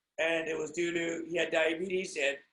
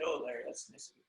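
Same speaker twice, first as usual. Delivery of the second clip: much softer, lower gentle thanks; soft-spoken guest; 5 semitones lower, 9 dB quieter, faster than usual. Retold or imitated. Guest thanking Larry, softly and low. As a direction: say it chill and quiet. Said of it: "he's very soft-spoken".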